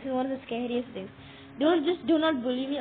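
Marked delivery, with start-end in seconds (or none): 1.6-2.8 loud plea. Real, not acted. Pleading with monsters.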